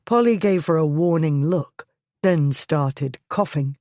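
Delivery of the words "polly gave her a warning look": ordinary delivery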